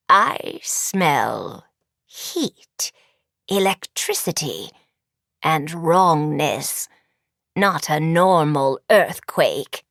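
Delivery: slow, low witch voice, word by word; ominous old sorceress. A narrator's reading. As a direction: sense danger, slowly and darkly. Sorceress sniffs the air, sensing trouble.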